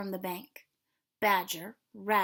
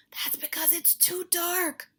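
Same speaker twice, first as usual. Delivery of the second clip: hushed frog whisper, pitched higher; 7 semitones higher than usual. Acted. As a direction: breathe it to friends in the dark. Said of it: "whispered Frog".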